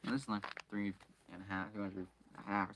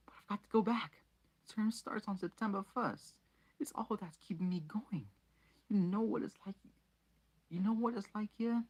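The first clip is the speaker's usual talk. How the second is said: put-on slightly higher pleading boy; a hint of a desperate schoolboy; lightly voiced boy; 10 semitones higher than usual. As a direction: beg to go back to school. Harry's voice.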